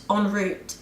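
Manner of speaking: "en route" with route as "root"; plain British "en route", oo vowel; British pronunciation. Shown as a demonstration. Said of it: "is the way a Brit".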